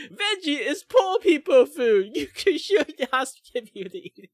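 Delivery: high, loud sneering mockery; mocking high voice. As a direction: squeal it out like a snob. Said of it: "talking shit like that".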